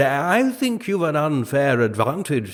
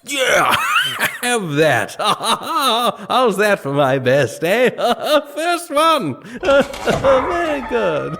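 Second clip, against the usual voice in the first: loud gleeful gloating with laughter; laughing gloat; 6 semitones higher, 4 dB louder, slower than usual. Acted. King laughing and gloating at Sackcloth during tiddlywinks. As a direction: cackle over your good shot.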